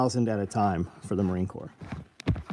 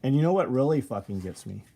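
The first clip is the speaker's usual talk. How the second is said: ordinary talk, nothing marked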